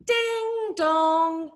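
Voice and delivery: high ringing two-note chime; long, sustained ding dong; clock chime imitation. Shown as a demonstration. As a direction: sing it out like a clock bell. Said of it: "it was a ding dong sound".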